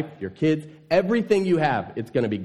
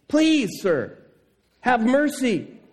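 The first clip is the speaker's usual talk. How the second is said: high, slow mock plea; pleading servant; 9 semitones higher, slower than usual. Preacher acting the servant pleading with his creditor, high pleading. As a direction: beg like the desperate servant.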